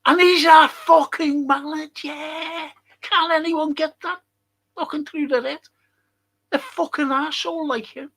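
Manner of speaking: high-pitched angry outburst; exasperated rant. Real, not acted. Fan ranting about the manager, exasperated and high-pitched.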